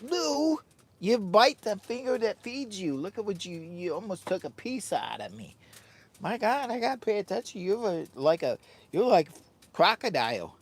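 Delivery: high-pitched scolding of the dog, a bit fast at the end. Real, not acted. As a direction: talk up high to the pet who nipped your finger. Scolding the dog that nipped him.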